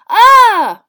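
very loud, high cry of shock; startled yell. Acted. Water balloon bursts on his head, startled yell. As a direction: yell out as cold water hits. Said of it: "A large red water-filled balloon had dropped".

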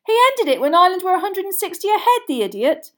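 high, loud, fast complaint; indignant bellow. A narrator's reading. Boy exasperated by the seeker's choice, indignant shouting. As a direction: rattle it out over the cheering. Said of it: "Ron bellowed".

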